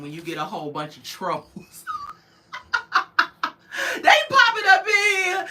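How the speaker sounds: usual speaking voice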